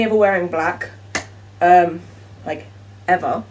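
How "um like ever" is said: usual speaking voice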